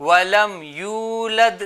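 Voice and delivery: slow, measured tajweed recitation; Quran recitation. Shown as a demonstration. Teacher models the verse for children, slow recitation. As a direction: recite slowly with drawn-out vowels.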